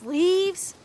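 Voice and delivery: ordinary delivery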